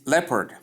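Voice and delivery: "leopard" as LEP-erd, two syllables; correct leopard. Shown as a demonstration. Teacher introducing the next word, leopard pronounced LEP-erd. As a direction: leave the o out of "leopard". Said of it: "but pronounced as leopard".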